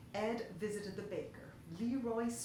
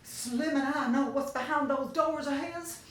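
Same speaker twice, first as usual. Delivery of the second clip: shivering; 6 semitones higher, 8 dB louder than usual. Acted. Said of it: "Esma began to shiver".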